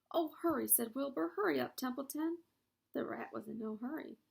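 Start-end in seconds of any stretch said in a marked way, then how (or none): 0.1-0.7 urgent. A narrator's reading. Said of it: "Wilbur urges". 1.4-2.4 urgent. A narrator's reading. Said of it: "Wilbur urges".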